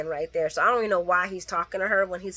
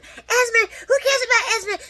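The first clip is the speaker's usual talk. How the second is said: high, loud scoffing shout; high mocking scoff at Esme; mock outraged yell; 13 semitones higher, 4 dB louder than usual. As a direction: mimic his scornful yell, pitched high. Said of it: "that's when Jocelyn come out like, Whoa".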